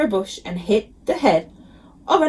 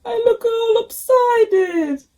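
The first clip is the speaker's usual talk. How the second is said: put-on glum kid god; 13 semitones higher, 3 dB louder, slower than usual. A narrator's reading. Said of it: "he said".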